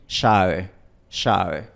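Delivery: "sabe" con sh suave; ecuatoriano con sh. Shown as a demonstration. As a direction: di "sabe" como "shabe". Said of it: "En vez de decir "sabe", dice "sabe". ("sabe" con ese asibilada)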